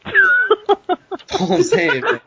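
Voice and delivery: said through a high, slow laugh. Real, not acted. Wrapping up his rant to the podcast hosts, laughing. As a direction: laugh the words out, can't hold it in. Laughing after calling Tom out.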